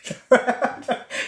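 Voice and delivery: real breathy burst of laughter; laughing. Real, not acted. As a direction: break up laughing. Breaks up over the comment.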